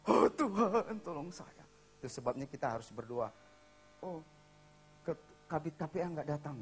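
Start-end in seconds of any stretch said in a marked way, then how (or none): 0.1-1.6 desperate plea. Retold or imitated. Single person begging God for a partner, mock desperate wail.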